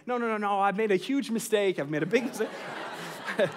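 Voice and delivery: hammed-up pleading that climbs into a laughing wail; dad mimicking his son's bedtime panic, mock desperate; mock child panic. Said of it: "he starts kinda almost panicking".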